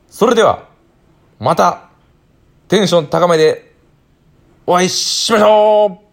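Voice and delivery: bright, high, loud sign-off; high energy. Real, not acted.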